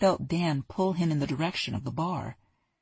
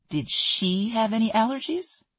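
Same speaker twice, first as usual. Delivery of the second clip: ordinary delivery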